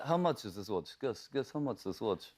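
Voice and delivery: imitated Russian accent, coaxing a guess; Russian-accented quizzing, broken English; in-character Russian accent. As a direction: retell it in an actor's Russian accent. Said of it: "he stays in character".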